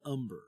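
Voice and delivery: "Umber" with the H left off; silent H. Shown as a demonstration. Co-host suggests how the river is said, "Umber" without an H. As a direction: say "Umber", starting straight on the vowel. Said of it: "they just don't say the H".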